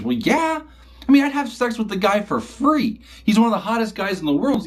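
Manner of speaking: put-on high, eager teen-girl voice; sister's voice. Sister answers eagerly in story.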